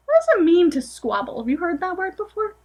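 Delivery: usual speaking voice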